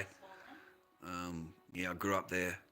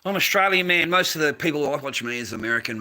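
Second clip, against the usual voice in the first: much higher, much louder emphatic answer; raised voice, pitched right up; loud insistence; 7 semitones higher, 9 dB louder than usual. Real, not acted. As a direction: call it out over the noise. Repeats answer, calling out louder.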